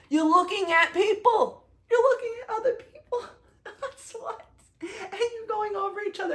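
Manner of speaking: high, laughing through the words; laughing excitedly. Real, not acted. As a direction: squeal with giddy laughter. Laughing excitedly recalling coaster.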